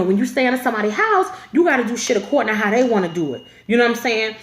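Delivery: ordinary talk, nothing marked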